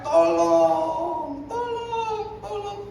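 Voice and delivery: high, rising pleas that fade away; hammed-up cry for help. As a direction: plead for help, running out of strength. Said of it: "dia teriak". (teriak minta tolong makin melemah)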